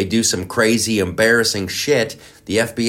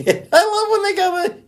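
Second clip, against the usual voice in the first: laughing. Real, not acted. Laughing about the CNN guest.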